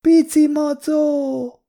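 put-on very high, drawn-out toddler voice; little girl. A narrator's reading. Little girl pointing at the juice box, high childish voice. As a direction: squeal it slowly like a small child. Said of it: "mutatott a dobozos italra a kislány".

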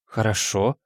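put-on mildly hesitant young woman; tentative, unsure reply; uncertain. A narrator's reading. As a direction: say it without conviction. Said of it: "неуверенно проговорила Ветрана Петровна".

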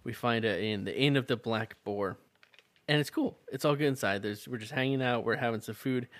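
normal talking voice

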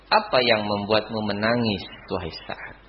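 soft, low caring question; gentle question. Retold or imitated. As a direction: ask tenderly, voice dropping away at the end. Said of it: "dengan kelembutannya bertanya". (bertanya dengan lembut dan rendah)

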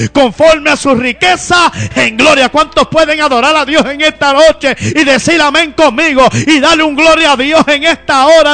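fervent Pentecostal preaching, loud and driving; preaching at full force. Acted. Preacher rousing the congregation about finances, full-force preaching.

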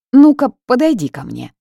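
loud, high commanding call. A narrator's reading. Heroine calls the house spirit over, loud command. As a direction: summon him over firmly.

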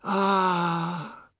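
high, drawn-out moan; sustained matriarchal moan; long drawn "ah". A narrator's reading. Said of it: "Missus Tulsi said".

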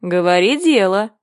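a measured, severe command; stern. A narrator's reading. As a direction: tell her sternly to get to the point. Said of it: "строго остановил её червяк".

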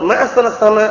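"ma ahsanas sama" ending on a, the exclamation reading; teacher demonstrates "sama" with a final a. Shown as a demonstration. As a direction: finish "sama" on an open a. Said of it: "Beda semua artinya nanti".

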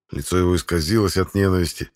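usual speaking voice

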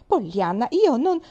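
a sharp cry cut short; cut-off protest. A narrator's reading. Aunt interrupted mid-protest, loud and high.

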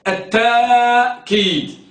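"التأكيد" said slowly with a long aa in place of the hamza; alif form drawn out. Shown as a demonstration. Teacher showing how the hamza becomes alif, slow and drawn out. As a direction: stretch the aa of "التأكيد", no glottal stop. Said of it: "فتبدل من جنس حركة ما قبلها".

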